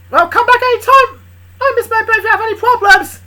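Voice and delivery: very high, loud holler; calling out. Acted. Charlie calling after a client to come back anytime, hollering. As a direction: shout it cheerily after someone.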